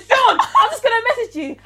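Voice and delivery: high, loud, laughing outburst; excited retelling. Retold or imitated. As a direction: act out an excited message to a friend. Retells excited message to friend.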